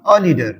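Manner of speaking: अ leader with a short uh for the article; short neutral अ sound on the article. Shown as a demonstration. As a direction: say अ, not ए, before leader. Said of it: "अ पढ़ना".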